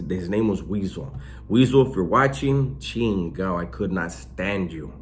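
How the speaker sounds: usual speaking voice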